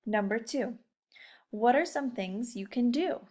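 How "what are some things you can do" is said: ordinary talk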